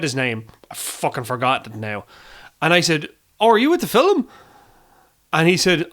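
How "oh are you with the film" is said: high, louder, bright-eyed small-talk question; sing-song polite question to a stranger; imitating his own chirpy question. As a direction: replay your own eager, oblivious question, pitched well up. Retold awkward question to stranger.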